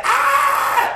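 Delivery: sudden high yell at dad; retold scream. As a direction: shriek it out, high. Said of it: "I said".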